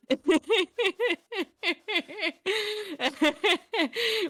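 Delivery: long high-pitched laugh. Real, not acted. Laughing at the joke.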